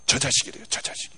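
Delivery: hushed whisper, pointing him out; whispered gossip. Retold or imitated. Shopkeeper couple whispering about the pastor passing by. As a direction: whisper it so he shouldn't hear.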